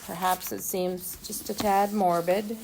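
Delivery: ordinary delivery, nothing marked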